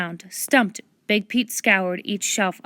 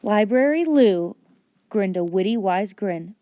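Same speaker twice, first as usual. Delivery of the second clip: ordinary delivery, nothing marked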